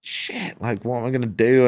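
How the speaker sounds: panicked, high-pitched cry of alarm; retold panic. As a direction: voice leaping up in fright. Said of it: "I'm like".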